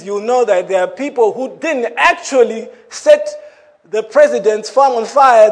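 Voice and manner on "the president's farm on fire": ordinary talk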